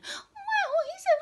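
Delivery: very high tiny mouse voice; high squeaky mouse. Acted. Mouse character.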